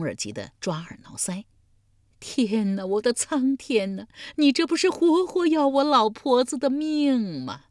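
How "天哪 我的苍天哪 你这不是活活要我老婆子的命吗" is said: put-on high, loud old woman's lament; hysterical wailing. A narrator's reading. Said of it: "老妪几乎又是哭昏过去". (哭天喊地，几乎哭昏)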